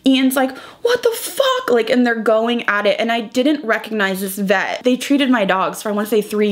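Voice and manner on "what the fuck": high-pitched imitation of an outraged yell; mock screamed disbelief at normal volume; retold screaming. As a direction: imitate his outburst, pitch leaping up. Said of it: "begging Ian to please stop screaming".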